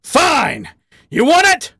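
loud, high-pitched angry challenge; explode, daring the other to fight; furious challenge. Acted. Badger scrambling up to floor his lover, furious.

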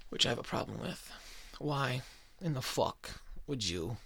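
ordinary talk, nothing marked

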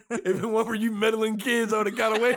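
hammed-up cartoon villain, laughing through it; Scooby-Doo villain line. Caught villain blaming the meddling kids, hammy. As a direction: ham up the caught villain's complaint. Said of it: "It's like".

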